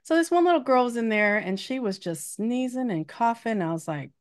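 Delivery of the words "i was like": usual speaking voice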